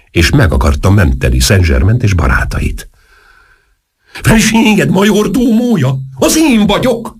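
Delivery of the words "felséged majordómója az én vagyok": very high, slow indignant-style cry; high-pitched exclamation. A narrator's reading. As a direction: call it out high and stretched. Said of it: "kiáltotta Antas".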